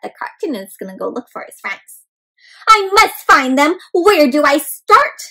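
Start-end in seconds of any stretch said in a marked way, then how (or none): 2.7-5.2 determined egg. Acted. Shell sets off searching.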